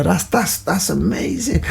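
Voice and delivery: mock awe, pitched high; Mister Gill. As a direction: imitate a teacher marvelling at someone's lunch. Teacher still admiring the baguette.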